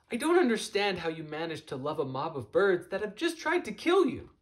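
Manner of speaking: put-on high, rattled young voice tumbling over itself; a hint of a frantic young bird; young gull Fletcher, panicked. Fletcher, confused after the cliff, asking Jonathan in alarm.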